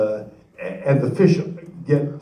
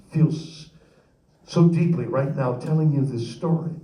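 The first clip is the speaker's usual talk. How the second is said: ordinary talk, nothing marked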